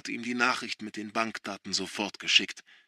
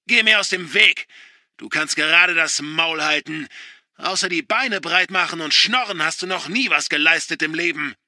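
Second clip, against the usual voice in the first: loud, higher venomous yell; contemptuous shout; 6 semitones higher, 9 dB louder than usual. A narrator's reading. Father shouting down his daughter, contemptuous. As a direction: spit the insults at her.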